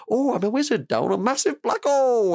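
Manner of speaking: mock high, loopy rambling; rambling ketamine tripper. Retelling a clip of a guy on ketamine, high-pitched mock rambling. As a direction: imitate a man off his head, pitched right up. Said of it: "rambling to himself".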